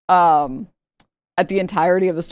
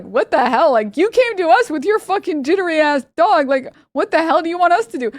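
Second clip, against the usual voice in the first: much higher, incredulous mock outrage; exasperated vets; 10 semitones higher, 4 dB louder than usual. Vets snapping back at the lady, exasperated mock outrage. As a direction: throw your hands up at her.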